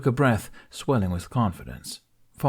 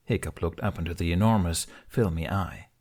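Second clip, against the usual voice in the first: ordinary delivery, nothing marked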